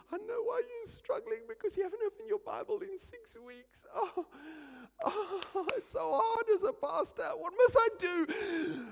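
very high, strained inner wail; mock pained lament. Pastor inwardly lamenting over struggling Sharon, mock high wail. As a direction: whine it out, hammed-up despair.

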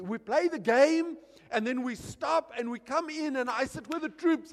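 ordinary talk, nothing marked

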